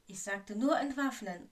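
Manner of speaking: loud, insistent warning. A narrator's reading. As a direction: raise the voice to warn them off. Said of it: "warnte Lockhart".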